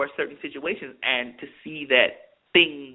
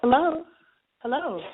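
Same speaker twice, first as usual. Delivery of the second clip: porn star voice; 11 semitones higher than usual. Acted. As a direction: coo it seductively, high up. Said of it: "my porn star voice".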